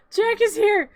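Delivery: high, loud flustered cry; frantic announcement. Real, not acted. Announcing Jack's arrival amid the chaos, high and frantic.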